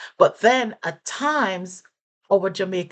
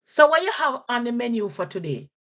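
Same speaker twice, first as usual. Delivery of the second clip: Jamaican patois; 5 semitones higher than usual. Shown as a demonstration. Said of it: "And I'll repeat that".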